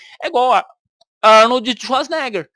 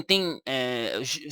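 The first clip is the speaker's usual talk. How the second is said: ordinary delivery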